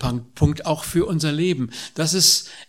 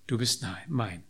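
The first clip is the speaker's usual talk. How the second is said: tender promise; 7 semitones lower, 10 dB quieter than usual. Acted. Tender close of God's promise.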